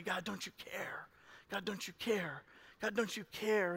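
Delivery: soft, breathy plea to God; pleading whisper. Retold or imitated. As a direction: whisper it, hurt and asking.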